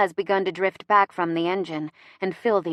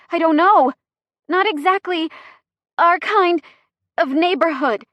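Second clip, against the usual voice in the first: high, loud, broken phrases; panting; 9 semitones higher, 6 dB louder than usual. A narrator's reading. Chrissy in labor answers the driver, panting. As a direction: pant it out in short bursts. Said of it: "she pants".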